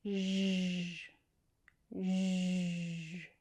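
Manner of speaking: long buzzing Zh, repeated; slow, isolated Zh demonstration; zh sound. Shown as a demonstration. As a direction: sustain each Zh on its own. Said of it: "zh sound".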